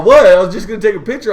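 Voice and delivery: imitating a defensive friend, high and loud; quick, loud retold protest. Friend defending his photo to the passenger, mock indignant protest.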